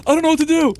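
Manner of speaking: high, loud mock-panicked squeal; imitated panic. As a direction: mimic her shrieking in helpless fright. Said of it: "she looks at me, she's like".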